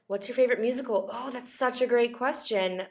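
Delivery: usual speaking voice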